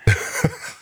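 quick burst of laughter; short laugh. Real, not acted. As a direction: laugh at your own joke. Laughs at danger room joke.

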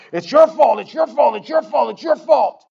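high, loud repeated accusation; accusing shout. Retold or imitated. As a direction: hammer the blame like a prosecutor. Accusing lawyer voice in head.

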